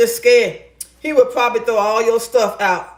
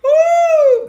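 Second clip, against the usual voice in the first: loud, very high "woo"; high whoop; 6 dB louder than usual. Real, not acted. Woman winding down from a funny story, high whoop. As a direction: let out a piercing woo after laughing.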